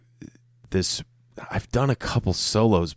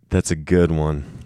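ordinary talk

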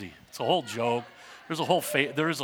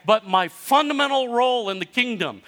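loud, high pulpit exhortation; fiery preaching; 8 semitones higher, 5 dB louder than usual. Acted. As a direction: drive it home to the congregation. Preaching climax at full force.

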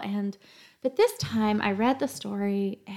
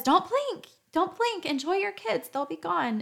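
much higher, singsong imitation of everyone's advice; mock well-meaning advice; 8 semitones higher than usual. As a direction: parrot the clichéd parental warning. Everyone's advice about kids.

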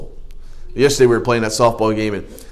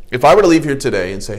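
ordinary delivery

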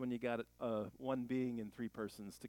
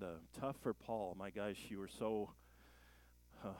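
soft, low prayer voice; closing prayer; 4 semitones lower, 4 dB quieter than usual. Acted. Pastor closing the sermon in quiet prayer. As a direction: pray quietly for the congregation.

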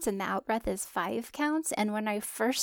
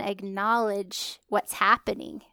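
ordinary delivery

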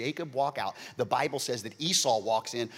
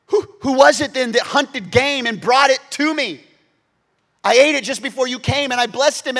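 much higher, loud impassioned sermon; preaching at full force; 13 semitones higher, 15 dB louder than usual. Acted. Preacher tells the stolen blessing to his church at full force. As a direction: drive it home to the congregation.

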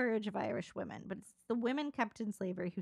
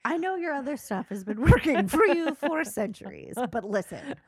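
higher, louder, hammed-up sales pitch; mock missionary pitch; 7 semitones higher, 8 dB louder than usual. Newcomer pitching Christianity to the Irish, hammed-up pitch. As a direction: sell it to a doubtful crowd.

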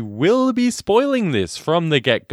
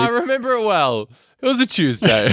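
laughing; 10 semitones higher than usual. Real, not acted. Joking about repeated conversation.

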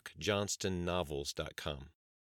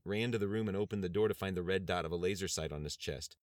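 plain reading voice

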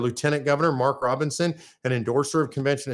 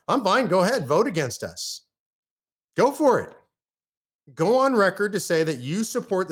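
sarcastic dare; 5 semitones higher than usual. Acted. Daring the state senators to vote against him, sarcastic taunt. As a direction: goad them, pitch jumping up.

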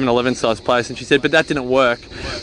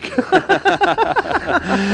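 high laugh. Real, not acted. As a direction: burst of high-pitched laughter. Laughing at their own excitement.

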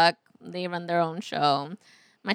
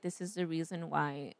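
quiet inner voice; 5 semitones lower, 14 dB quieter than usual. Retold or imitated. Quoting own thought, very quiet.

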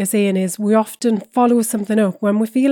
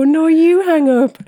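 slow, teasing phone goodbye; giggly late night call; 8 semitones higher, 6 dB louder than usual. Retold or imitated. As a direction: coo it like a lovestruck teen. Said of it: "when you were younger and you go".

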